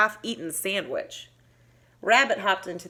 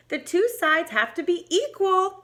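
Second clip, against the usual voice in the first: put-on loud child's call; child shouting; 9 semitones higher, 4 dB louder than usual. A narrator's reading. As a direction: call it out across the playground. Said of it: "one of them shouted".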